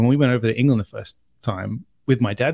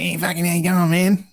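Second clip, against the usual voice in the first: higher-pitched broad Australian tradie voice; Aussie tradie accent; 6 semitones higher than usual. Retold or imitated. Carpet worker fitting in with tradie workmates, broad Aussie accent. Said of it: "blue, yeah, Aussie tradies".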